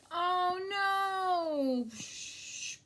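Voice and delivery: normal talking voice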